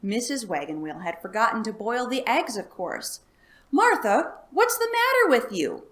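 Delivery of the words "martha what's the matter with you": high, loud angry yell at his wife. Acted. Farmer yelling at his wife over raw eggs, loud and high. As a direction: shout at her over the raw egg. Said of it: "yelled Mister Wagon Wheel".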